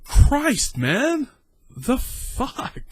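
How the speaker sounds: loud, high-pitched disbelief; exasperated outburst. Real, not acted. Exasperated at player's death threats.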